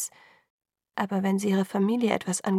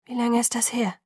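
drained, hollow question; 3 semitones higher than usual. A narrator's reading. Alice questioning Bella about the call, hollow voice. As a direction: ask it emptily, with little force. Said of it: "fragte sie mit hohler Stimme".

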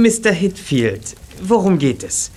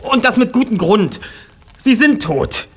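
insistent; 7 semitones higher than usual. Acted. Insisting his parents are dead.

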